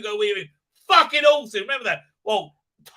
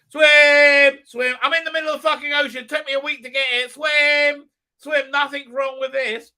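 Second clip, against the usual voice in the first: high, loud, fast huffing self-talk; mock determined swimmer; 8 semitones higher, 8 dB louder than usual. Galadriel alone in the ocean urging herself on, high mock grunting. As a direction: chant it like a stubborn swimmer.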